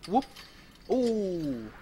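high whoop, then a long ooh; long swooping exclamation. Real, not acted. Swinging through the game.

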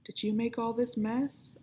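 ordinary delivery, nothing marked

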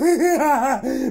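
short real laugh. Real, not acted. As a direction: burst out laughing. Laughing at the cat.

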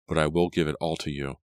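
ordinary delivery, nothing marked

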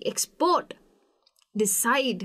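"Export" exPORT, "decide" deCIDE; back-stressed "export", "decide"; stress on last syllable. Shown as a demonstration. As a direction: lean on the ends of "export" and "decide". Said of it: "उसके ऊपर मैं ज़्यादा emphasise".

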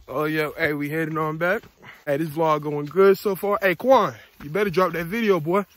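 usual speaking voice

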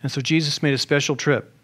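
usual speaking voice

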